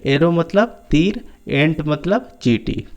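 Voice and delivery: ordinary talk, nothing marked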